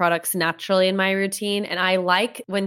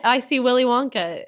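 usual speaking voice

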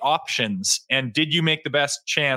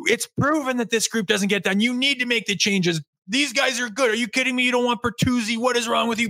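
raised, ranting fan voice; mocking angry fan; 8 semitones higher than usual. Fan mocking outraged fans calling for changes, ranting. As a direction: mimic the furious fans demanding changes. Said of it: "People would be lighting the city on fire saying".

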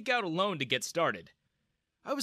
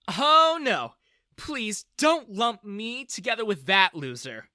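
loud, high scornful denial; disdainful; 8 semitones higher, 8 dB louder, slower than usual. A narrator's reading. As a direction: brush off her father. Daughter disowning father.

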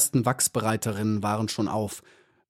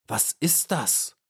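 slow, higher-pitched astonished question; astonished call; 4 semitones higher, 3 dB quieter, slower than usual. A narrator's reading. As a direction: draw out the question in amazement. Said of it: "riefen sie". (erstaunter, gedehnter Ausruf)

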